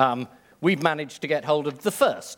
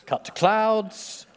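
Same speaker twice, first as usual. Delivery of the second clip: slow, higher deadpan direction; slow stage direction; 7 semitones higher, much slower than usual. Acted.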